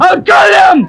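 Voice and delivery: same threat again, now breaking into a cry; crying rage. Acted. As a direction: let the threat crack into a wail. Threat repeated, escalating.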